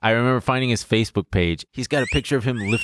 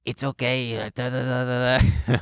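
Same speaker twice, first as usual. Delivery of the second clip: put-on kid's raspy voice; 3 semitones higher than usual. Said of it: "who had a raspy voice".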